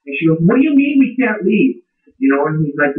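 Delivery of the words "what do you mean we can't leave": high-pitched, mildly incredulous retort; alarmed disbelief. Retold or imitated. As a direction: retell her alarmed question, pitch jumping up. Retold alarmed challenge when stranded.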